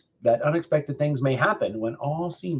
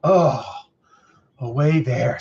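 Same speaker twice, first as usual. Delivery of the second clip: loud drawn-out "oh" of relief; acted relief, settling after the first word; relieved; 5 dB louder, slower than usual. A narrator's reading. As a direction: let the worry drop away. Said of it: "mightily relieved".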